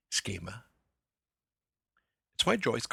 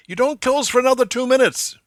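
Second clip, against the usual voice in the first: a sudden, much higher and much louder shout of protest; angry yell; 15 dB louder than usual. Retold or imitated. Hungry Stephen yelling at the post office clerk: furious shout. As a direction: bellow it at the clerk shutting the door early. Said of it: "yells at the post office tyrant".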